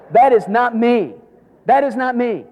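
stamp it down; emphatic insistence. Real, not acted. Preacher insisting loudly.

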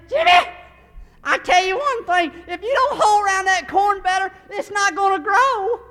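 high, very loud hammed-up scolding old lady; bossy old neighbor. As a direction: holler it like an old farm woman bossing the boy. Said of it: "She'd say".

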